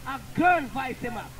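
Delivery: ordinary talk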